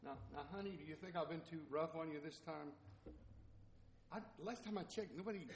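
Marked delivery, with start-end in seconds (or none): 0.0-2.7 put-on very soft, hushed mother coaxing a child; mock-tender parent, voice dropped right down; gentle coaxing mother. Mother to her four-year-old, gently coaxing. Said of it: "here's a mother, little child".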